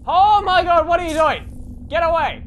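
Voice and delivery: loud frightened yelling; panicked shout. Real, not acted. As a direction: yell it in real panic. Panicked at animatronic.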